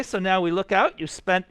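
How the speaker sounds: usual speaking voice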